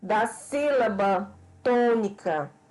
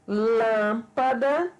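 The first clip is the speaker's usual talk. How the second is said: "lâmpada" very slow, LÂM held long; teacher's slow example "lâmpada", weight on LÂM; stress on the accented syllable; much slower than usual. Shown as a demonstration. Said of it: "O mesmo ocorre com o acento circunflexo".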